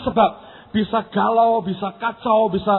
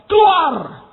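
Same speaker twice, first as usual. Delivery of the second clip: high, loud drawn-out order; forceful command; 6 semitones higher, 6 dB louder than usual. Acted. Believer orders the inner water out, forceful command. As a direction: command it out in one burst.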